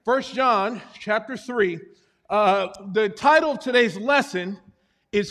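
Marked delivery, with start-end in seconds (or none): none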